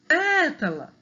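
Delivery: PÉTALA with PÉ lifted high; calling the word. Shown as a demonstration. Teacher showing first-syllable stress, calling PÉtala. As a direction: call out pétala, punching the first PÉ up. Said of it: "o pé é mais forte".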